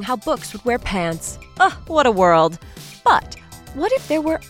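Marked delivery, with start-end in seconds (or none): none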